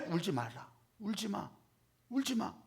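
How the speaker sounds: gentle comfort. Acted. Jesus to the weeping widow, soft and tender. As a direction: soothe her gently.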